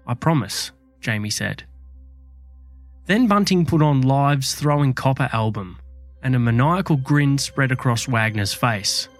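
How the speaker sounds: true crime narrator. A narrator's reading. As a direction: tell it like a dark true crime tale.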